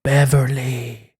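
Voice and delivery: slow, low ghostly call; mock haunting, drawn-out name; ghost impression. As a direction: stretch the name out like a spook. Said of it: "That's a reference to the Ghost episode".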